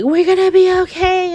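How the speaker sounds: high, loud mock-comforting Nina; imitating Nina cradling Sonny, hammed-up. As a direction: squeak out Nina's reassurance. Mimicking Nina soothing Sonny.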